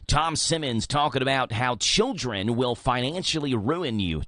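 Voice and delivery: ordinary talk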